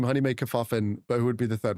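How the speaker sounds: normal talking voice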